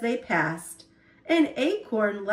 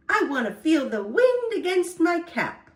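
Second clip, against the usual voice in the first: cheering; 5 semitones higher than usual. Acted. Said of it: "Acorn cheered".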